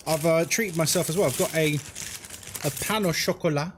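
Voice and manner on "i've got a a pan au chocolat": normal talking voice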